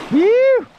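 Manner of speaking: loud high cheer; excited whoop. Real, not acted. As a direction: let out a joyful whoop. Fish landed.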